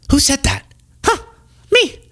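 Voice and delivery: usual speaking voice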